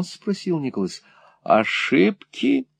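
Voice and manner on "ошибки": high, drawn-out incredulous query; indignant exclamation. A narrator's reading. Mother echoes her son's doubt, indignant exclamation. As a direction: echo the word slowly, pitch shooting up. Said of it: "воскликнула миссис Никлеби".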